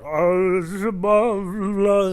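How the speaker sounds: put-on high, smeared drunken babble; imitated drunk slurring. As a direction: slur nonsense like a collapsing drunk. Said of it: "some drunk dude laying on the ground".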